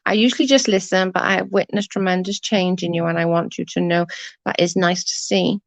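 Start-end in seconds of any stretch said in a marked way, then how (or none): none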